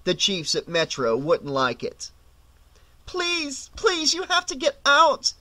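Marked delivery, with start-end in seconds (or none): 3.1-5.3 very high, desperate plea; begging. A narrator's reading. Woman pulling at passengers, begging them out. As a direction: beg in a high voice, starting small. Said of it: "she begged".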